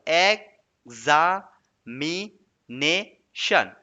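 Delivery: "एग्जामिनेशन" very slowly, a long pause after each syllable. Shown as a demonstration. Teacher counting syllables, "एग्जामिनेशन" slowly split. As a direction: break "एग्जामिनेशन" into five separate, drawn-out syllables. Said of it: "बस कितना syllable हुआ".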